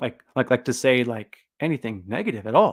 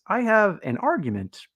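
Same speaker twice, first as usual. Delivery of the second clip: higher, meek polite plea climbing up; hammed-up timid academic asking to be heard; mock polite nerd; 10 semitones higher than usual. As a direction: play the ignored nerd adjusting his glasses. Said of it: "they adjust their glasses".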